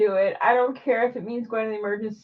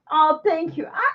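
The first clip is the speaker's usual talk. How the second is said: normal talking voice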